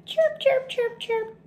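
very high tiny chirps; hungry little chicks chirping; squeaking chicks. Acted. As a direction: peep like baby birds in a nest. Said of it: "squeaked the chicks".

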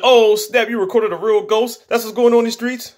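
high-pitched imitation of an excited reaction; hyped-up retold disbelief, voice held high; mock amazed excitement. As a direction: act astonished, pitched right up. Acting out Felton's amazed reaction.

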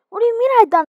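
high, loud indignant outburst; indignant shout. Acted. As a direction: fire it back in disbelief.

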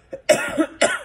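sharp real cough, loud burst. Real, not acted. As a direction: cough mid-sentence. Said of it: "I'm coughing and yawning".

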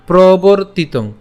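প্রবর্তিতং slowly, with র closing the syllable প্রবর; slow teaching demo of প্রবর্তিতং, split after র; careful pronunciation. Shown as a demonstration. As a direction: say প্রবর্তিতং as probor-titong, drawn out. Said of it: "উচ্চারণটা কেমন হচ্ছে".

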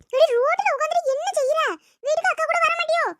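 high-pitched cross scolding. Acted. Mother scolding daughter sitting on the road, high scolding. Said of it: "அவளை திட்டாதியே".

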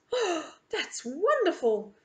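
high, wonderstruck gush; gasp, then ask with eager wonder; gasping delight. A narrator's reading. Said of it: "I gasp".

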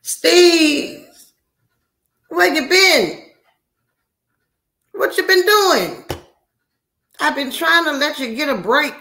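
very high, loud drawn-out holler; excited hollering. Real, not acted. Host hailing long-missed Steve, excited hollering.

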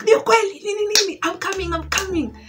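imitating shocked mom. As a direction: mimic a mom in disbelief, rushing over. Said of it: "She's like".

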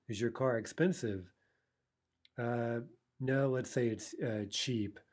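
ordinary talk

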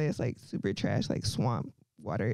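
low, slow disgusted drag; deep slow sneer at dating; disgusted drawl. Real, not acted. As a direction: drag it out like it smells. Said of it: "Like, ugh".

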